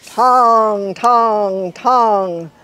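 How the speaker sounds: ringing bell tongs, loud and drawn out; booming bell sounds; bell imitation. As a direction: chime each tong like a big mission bell.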